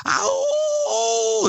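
sudden high screaming howl; imitated creature scream. Mimicking the thing outside the window, a high screaming howl. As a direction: let rip a wild creature scream. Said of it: "that thing went".

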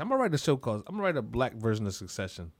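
ordinary talk

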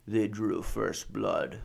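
low, slow, soft attempt at a gruff action hero; a clumsy stab at the Rambo line; movie quote growled quietly in slow motion; bad Rambo impression. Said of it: "there's nothing I'm worse at than impressions".

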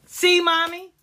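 high, loud imitation of a little girl; triumphant child. Granddaughter showing her mother she was right, triumphant child. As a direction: mimic a child proving her point.

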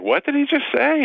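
high, incredulous What did he just say. Retold or imitated. People hearing Rudy's idiotic statement, high incredulous question. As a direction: pitch shooting up in mock disbelief. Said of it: "everybody's like".

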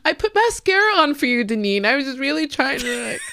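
real teary laughter; laughing through tears. Real, not acted. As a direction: laugh it off, half crying. Laughing, teary after emotional moment.

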